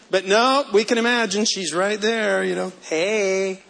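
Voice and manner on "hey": lightly raspy seductress voice; a hint of husky temptress; smoker's rasp. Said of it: "I gave her a little smoker's voice there at the end".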